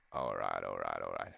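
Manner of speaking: very low actor's catchphrase; Matthew McConaughey. Acted. Warming up an impression, very low American voice. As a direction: drop the voice way down, American. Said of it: "Matthew McConaughey?".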